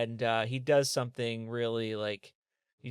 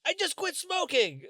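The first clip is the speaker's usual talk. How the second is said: much higher comic voice, deadpan excuse; quitter character. Acted. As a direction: toss off the excuse like it explains all. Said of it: "I like the character you're doing".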